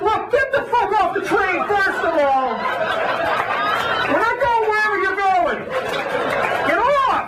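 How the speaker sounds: high mock outrage, half laughing; mock angry rant. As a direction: yell back at the conductor in disbelief. Ranting at the conductor's announcement.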